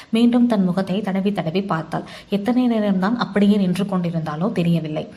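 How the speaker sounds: usual speaking voice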